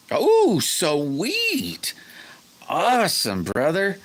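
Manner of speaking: high, loud burst of delight; excited cheer. Real, not acted. Streamer reacting to Thomas's news, high excited cheer. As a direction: whoop for a buddy's good news.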